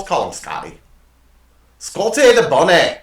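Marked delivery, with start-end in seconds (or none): 1.8-3.0 loud, high mock Scottish accent. Host naming the found stuffed bunny, mock Scottish accent. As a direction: belt it out in a botched Scottish accent. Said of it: "I just did a Scottish accent".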